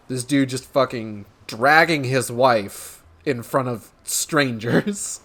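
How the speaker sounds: ordinary delivery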